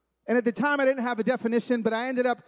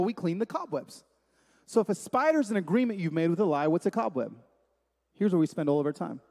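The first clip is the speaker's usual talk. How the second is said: ordinary talk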